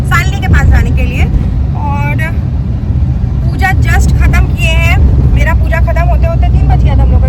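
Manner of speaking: talking over car noise. Real, not acted. Loud vlogging in car while traveling.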